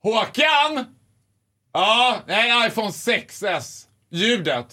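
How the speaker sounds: called out high to the back room; calling to a colleague. Retold or imitated. Tech guy calls his coworker: high, called out. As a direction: shout the name across the shop. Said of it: "så ropar han in typ så här".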